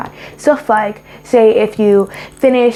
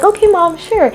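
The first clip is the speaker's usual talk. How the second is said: put-on agreeable child; 8 semitones higher than usual. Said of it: "I'm like".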